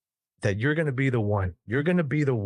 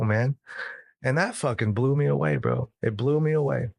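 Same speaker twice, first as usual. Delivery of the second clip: ordinary talk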